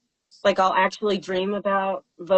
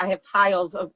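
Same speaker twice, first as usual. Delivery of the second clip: ordinary talk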